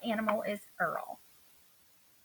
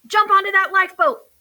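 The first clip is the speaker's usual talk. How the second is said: higher, louder urgent shout; 8 semitones higher, 11 dB louder than usual. Acted. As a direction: yell the order to jump aboard. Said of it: "shouted Earl".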